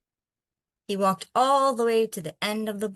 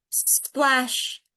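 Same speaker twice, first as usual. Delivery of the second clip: higher, bright splash word; splashy sound word; 5 semitones higher than usual. A narrator's reading. Reading to kids, the splash, a playful splash.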